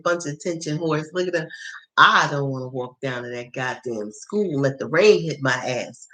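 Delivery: ordinary talk, nothing marked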